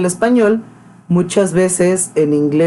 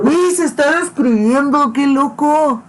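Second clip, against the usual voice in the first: a much higher, sarcastic wow; mock amazement; 9 semitones higher than usual. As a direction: pretend to be amazed, jeering. Mocks speaker describing himself. (asombro fingido y burlón)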